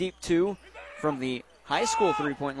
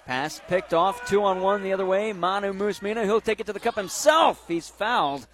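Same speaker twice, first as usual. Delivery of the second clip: excited play call; 4 semitones higher, 6 dB louder than usual. Real, not acted. Fast break call.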